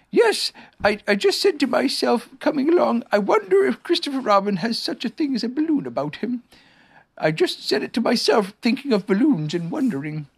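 high, musing storybook bear; Pooh bear, musing. Acted. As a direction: ramble innocently in a high bear voice.

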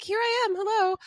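mock-coy high-pitched hello; playful self-presenting. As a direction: present yourself in a high, sing-out voice. Jokey self-promotion as the model.

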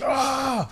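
high startled shriek at icy water, half laughing; laughing yelp from a freezing spray; mock shriek. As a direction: yelp like cold water just hit you. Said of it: "I'm like".

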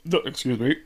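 talking through a burp; words pushed out on a burp. Real, not acted.

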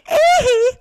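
very high, loud falsetto yelp. Retold or imitated. Salesman skipping away from the mom, loud falsetto ee-hee. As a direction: shriek it like the pop star mid-skip. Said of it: "that was a high-pitched ee-hee".

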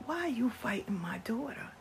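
hushed whisper; whispered question. Retold or imitated. Grandma asking grandson quietly, whispered. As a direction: lean in and whisper it. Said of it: "I said".

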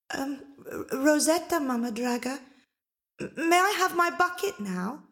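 put-on high, stammering girl; timid little girl. A narrator's reading. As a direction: answer shyly like a small child. Rosetta answers timidly.

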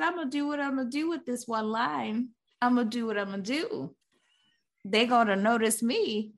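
determined bit-part actress. Retold or imitated. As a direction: imitate an actress set on being noticed. Imagined actress determined to stand out.

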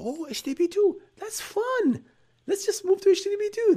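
high, fast, gushing enthusiasm; mock excited naive company. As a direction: chirp it like a careless fanboy. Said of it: "they saw".

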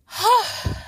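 high winded exhale; heavy breathy sigh. Real, not acted. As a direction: let out a tired sigh. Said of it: "sort of out of breath".

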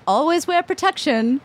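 high, put-on English accent. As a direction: advise readers in a mock English accent. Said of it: "you did an English accent".

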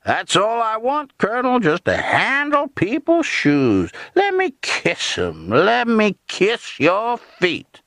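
high, stretched-out mock servility; vicious jeering imitation. A narrator's reading. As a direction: grovel in bitter, high-pitched parody. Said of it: "suddenly vicious, jeering imitation of his familiar patter".

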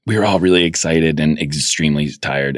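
normal talking voice

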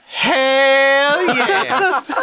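very high, drawn-out Southern holler; high-pitched Southern yell, held long; Tennessee Southern. Acted. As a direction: whoop it in a hammed-up Tennessee accent. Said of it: "Tennessee Southern".

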